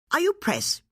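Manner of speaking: sharp high bark of a question. A narrator's reading. Lady Harliford confronting strangers at her door, barking. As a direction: snap it out like a guard dog. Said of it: "she barked".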